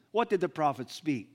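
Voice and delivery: usual speaking voice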